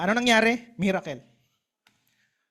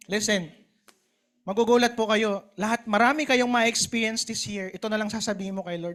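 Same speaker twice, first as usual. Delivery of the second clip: usual speaking voice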